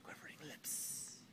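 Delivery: soft, unvoiced-sounding whisper for effect; hushed dramatic repeat. Shown as a demonstration. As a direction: whisper it as a creepy echo.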